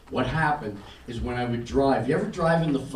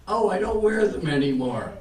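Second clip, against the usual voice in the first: higher, louder sudden realization; realising; 8 semitones higher, 5 dB louder than usual. Retold or imitated. Man at bedtime remembering his new eyes, surprised. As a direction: catch yourself, pleased surprise. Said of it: "I lay down in the bed and I go".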